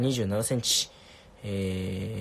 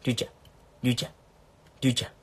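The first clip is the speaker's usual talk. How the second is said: lightly imitating a friend calling out; a short, repeated name-call, mimicking a friend; much faster than usual. Streamer mimicking a friend for viewers, light impression. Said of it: "これが翼の真似".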